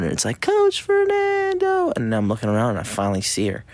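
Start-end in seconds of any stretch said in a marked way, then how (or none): 0.4-1.9 put-on high, slow, drawn-out kid's call, a bit louder; sing-song child shout; louder child's call. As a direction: call it out high and long like a little girl getting closer. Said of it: "it gets a little bit louder".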